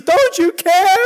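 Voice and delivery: high, loud, panicked plea; desperate cry. Acted. Frightened disciple calling to sleeping Jesus, loud and desperate. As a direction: cry out over the storm for help.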